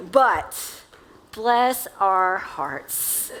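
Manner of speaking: drawn-out, measured sympathy; slow, deliberate. Real, not acted. Preacher gently teasing the church, slow and deliberate. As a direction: stretch each word right out.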